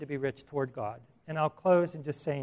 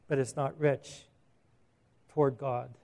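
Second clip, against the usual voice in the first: ordinary talk, nothing marked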